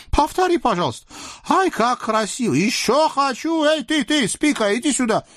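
high, whiny delighted sultan voice; clamor like a spoiled child; capricious child sultan. Acted. Sultan urges on the fight like a capricious child, high and gleeful. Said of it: "как капризный ребёнок".

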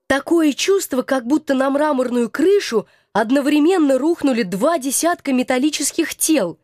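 ordinary delivery

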